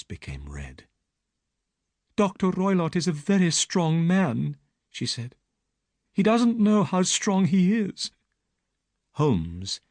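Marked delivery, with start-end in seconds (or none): 2.2-8.1 put-on young woman's voice, higher; frightened young woman telling her story; Miss Stoner. Young woman pleads with Holmes, higher voice.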